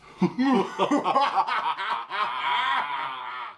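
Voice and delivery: loud evil cackle, shouted; villain laugh. Acted. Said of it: "Villain laugh on three".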